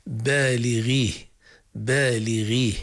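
بالغيه said slowly, with the غ kept at the lightest level of emphasis; بالغيه with a barely emphatic غ; lightly heavy خ and غ. Shown as a demonstration. Teacher slowly modelling بالغيه. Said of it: "هذا نجده في قول مثلًا".

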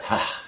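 "Ha" as a soft breath; soft, breathy "Ha". Shown as a demonstration. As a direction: let "Ha" out as an airy exhalation. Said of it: "He is a breath".

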